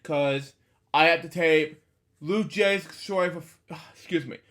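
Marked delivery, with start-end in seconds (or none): none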